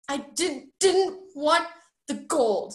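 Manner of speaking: high, halting, slowing denial; upset protest. A narrator's reading. Roderick denying he wanted the gold, upset and halting. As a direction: stumble into a tearful defence. Tearful boy defends himself.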